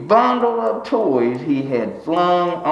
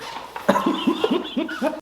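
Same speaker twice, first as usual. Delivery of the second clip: wheezy laugh; 5 dB quieter than usual. Real, not acted. Breathy laugh.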